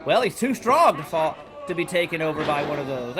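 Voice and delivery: ordinary delivery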